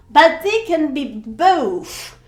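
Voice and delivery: ordinary delivery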